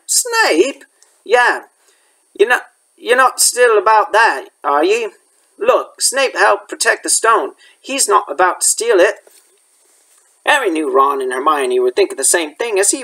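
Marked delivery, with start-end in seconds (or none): 0.1-0.8 sharp high startled question; shocked. Acted. Harry shocked by the name. 1.2-9.2 lightly voiced gamekeeper, a bit higher; a hint of Hagrid brushing it off; mild Hagrid voice, dismissive. Hagrid defending Snape.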